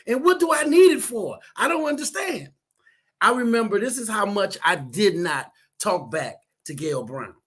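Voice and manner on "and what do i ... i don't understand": put-on high, puzzled kid voice; higher mock kid complaining; childish whine. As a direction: play the confused child, pitched up. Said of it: "And I'm like".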